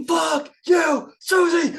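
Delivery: high, loud hammed-up scream; screamed punk line. Friend mocking a Charlie Brown blast-beat song, screamed high. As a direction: scream it like a hardcore vocalist. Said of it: "it'd be better if it was just blast beats".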